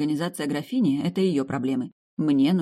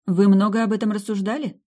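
higher, louder note of surprise; surprised question; 6 semitones higher, 6 dB louder than usual. A narrator's reading. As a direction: ask with audible astonishment. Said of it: "удивлённо спросила я".

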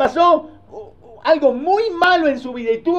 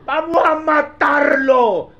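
menacing threat; 3 semitones higher, 4 dB louder, slower than usual. Retold or imitated. Brothers plotting murder.

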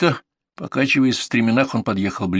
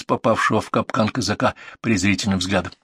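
usual speaking voice

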